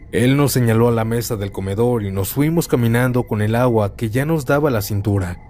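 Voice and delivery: ordinary delivery, nothing marked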